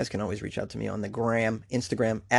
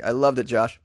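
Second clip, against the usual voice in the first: ordinary talk, nothing marked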